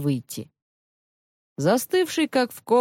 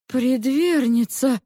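stunned; 9 semitones higher than usual. A narrator's reading. Lisian sees demon artifacts and questions the maid, stunned. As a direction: ask as if nearly speechless, voice raised high. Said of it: "чуть не потерял дар речи".

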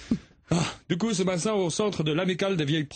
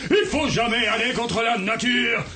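yelling with a laugh; furious shout; 5 semitones higher, 4 dB louder than usual. Acted. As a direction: bellow it at the crowd. Politician erupts at elderly audience.

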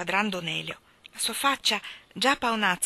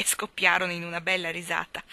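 ordinary delivery

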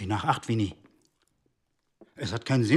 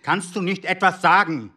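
angry demand; 6 semitones higher, 6 dB louder than usual. Acted. Husband berates his silent wife.

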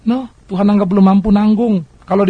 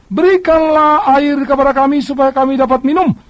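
demanding Israelites, higher and louder; grumbling people demanding water from Moses, higher and louder; complaining crowd; 7 semitones higher, 4 dB louder than usual. Acted.

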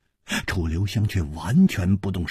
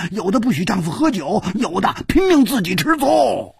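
much higher, animated listing; excited rant; 10 semitones higher than usual. A narrator's reading. Hu Tiehua lecturing Chu Liuxiang about women, higher. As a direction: rattle off the complaints with gusto.

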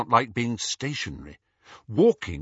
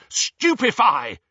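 high, slow shouted spell; yelling a spell; 11 semitones higher, 5 dB louder, slower than usual. Acted. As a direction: yell the spell at the enemy.

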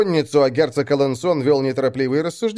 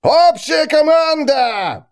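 high, loud, slow parade command; shouting drill sergeant; 6 dB louder, much slower than usual. Acted. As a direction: bellow it across the ranks. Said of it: "разнеслось над строем".

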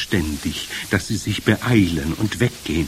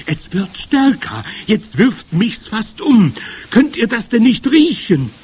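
alarmed witch; 12 semitones higher, 7 dB louder than usual. Acted. Sniffing witch finds the smell growing, alarmed. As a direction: drag the words out high and louder.

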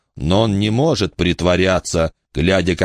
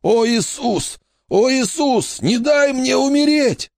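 terrified plea. A narrator's reading. Dying man begs for life.

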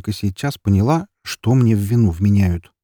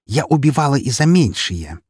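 higher-pitched, venomous boast; menacing threat; 7 semitones higher, 3 dB louder than usual. A narrator's reading. Эри threatens the neighbour woman, menacing. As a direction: threaten with open hostility. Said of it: "прошипела она".

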